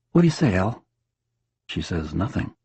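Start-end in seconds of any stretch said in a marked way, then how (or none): 0.1-0.8 bright husband chatter. Acted. Husband coaxing dead wife.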